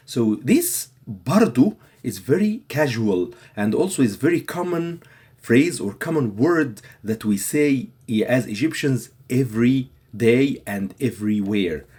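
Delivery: normal talking voice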